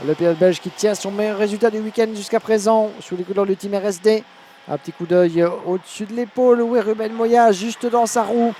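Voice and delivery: urgent play-by-play, pressing forward; motorsport commentator. Acted. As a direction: call the last lap with urgency. Live French race commentary.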